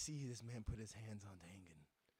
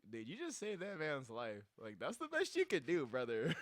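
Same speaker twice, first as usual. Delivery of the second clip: loud, higher teasing; a friend loudly teasing Tangen; mock praise; 3 semitones higher, 7 dB louder than usual. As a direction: rib him loudly.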